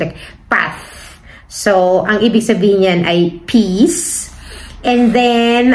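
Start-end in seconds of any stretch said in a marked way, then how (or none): 0.0-1.2 path with a soft th ending, nearly breathed out; Spanish z as th. Shown as a demonstration. Teacher showing the Spanish z sound as th. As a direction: say path with th, not s. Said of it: "as like T H".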